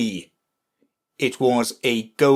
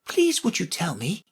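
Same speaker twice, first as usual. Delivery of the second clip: higher, tentative Alice; timid request; 9 semitones higher than usual. A narrator's reading. Alice to the Red Queen, mildly timid. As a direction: ask with a touch of timidity. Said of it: "looking timidly at the Red Queen".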